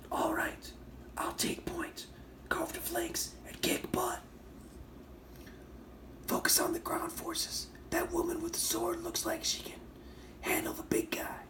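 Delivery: high, soft whispered voice effect; altered character voice. Acted. Reviewer reading a comic character's line in a high whispery effect voice. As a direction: whisper it high through a voice changer. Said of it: "I'm gonna do the voice".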